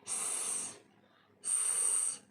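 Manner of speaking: स as a soft unvoiced hiss, no vowel; just hiss स; hissed S sound. Shown as a demonstration. Teacher hisses स for students. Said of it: "हम इसकी सिर्फ sound निकालेंगे".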